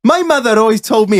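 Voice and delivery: put-on high, lilting Welsh accent. Welshman quoting his mother mid-argument, Welsh accent. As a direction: imitate a Welshman starting a grievance. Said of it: "I'll do my best Welsh accent".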